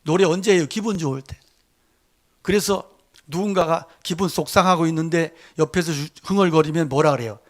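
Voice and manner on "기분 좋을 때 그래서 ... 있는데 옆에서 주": ordinary talk, nothing marked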